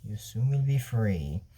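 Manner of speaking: low, drawn-out, softened delivery; low and slow. Acted. Shade promises freedom, low and slow. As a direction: say it slowly, down low and quiet. Villains' spoken threat or promise.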